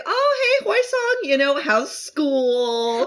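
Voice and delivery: lightly voiced, high, upbeat friend; a hint of a cheerful elder checking on a kid; cheery older friend. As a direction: chirp it like a friendly big brother. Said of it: "And so he's like".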